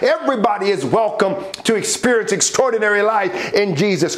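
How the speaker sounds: rousing praise, pitched high and sustained; preacher lifting the congregation into praise; fervent preaching. Real, not acted. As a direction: build from thanks to a high, rallying proclamation. Rises into praise and exhortation.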